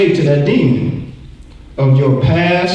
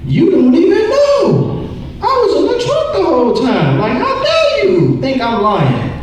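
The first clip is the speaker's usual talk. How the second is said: much higher, laughing fake-innocent kid voice; indignant kid. Boy lying to his mom about where he was, high and indignant. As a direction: protest your innocence like a caught child.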